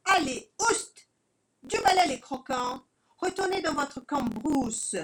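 mocking villain rooster; arrogant rooster. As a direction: shoo the peasants away.

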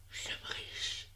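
soft unvoiced whisper. A narrator's reading. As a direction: breathe it into his ear. Lovers whisper secret triumph.

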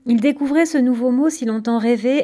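plain reading voice

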